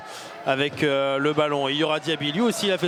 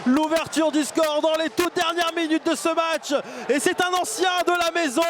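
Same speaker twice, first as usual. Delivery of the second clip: excited goal call; 7 semitones higher than usual. Real, not acted. Goal called by commentator.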